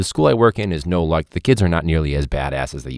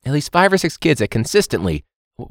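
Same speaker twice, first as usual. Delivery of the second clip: ordinary delivery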